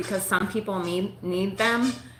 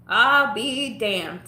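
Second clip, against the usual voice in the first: slow drawn-out; 4 semitones higher, 7 dB louder, much slower than usual. Real, not acted. Punchline after doctor story.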